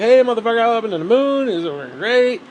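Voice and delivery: hammed-up thrilled spaceman; excited astronaut. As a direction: yell it like you just got back from the moon. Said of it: "you'd be talking like that".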